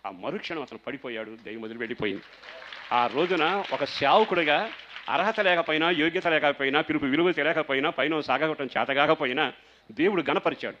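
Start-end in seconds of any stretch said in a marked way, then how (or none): 2.9-10.8 louder, slow proclaiming; preaching. Acted. Pastor testifying to the church, slowly proclaiming. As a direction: declare each clause slowly and firmly.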